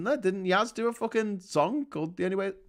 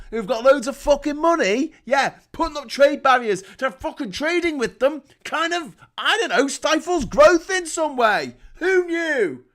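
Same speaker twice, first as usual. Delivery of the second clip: sarcastic rant; 9 semitones higher, 6 dB louder than usual. Real, not acted.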